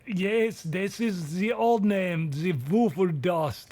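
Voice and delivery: put-on Santa slipping into a German accent; German-accented Santa explaining. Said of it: "get rid of this German accent".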